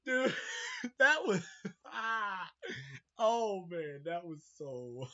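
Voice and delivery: breathless, slow words through laughter; after laughing hard. Real, not acted. Cracks up over scene.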